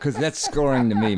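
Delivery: laughing while talking; words through laughter. Real, not acted.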